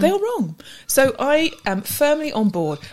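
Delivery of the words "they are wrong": pitched up, pretend outrage on wrong; 假装愤慨，声调抬高; higher mock-indignant verdict. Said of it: "firm statement".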